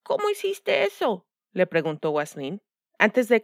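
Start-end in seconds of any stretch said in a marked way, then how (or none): 0.0-1.2 put-on high, light young girl voice; a curious little girl asking; voz de niña. A narrator's reading. Niña Waslin pregunta. (voz aguda de niña)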